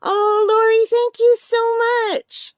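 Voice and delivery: high, heartfelt gush of gratitude; overjoyed thanks. Real, not acted. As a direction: thank an absent friend, overwhelmed with delight. Thanks friend for surprise gift.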